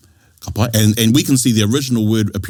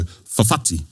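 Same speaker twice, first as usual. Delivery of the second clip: "fafati" slowly as fa-FA-ti; stress on base word; 3 dB quieter, slower than usual. Shown as a demonstration. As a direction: hold the stress on the second syllable of "fafati". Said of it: "where we hold our stress".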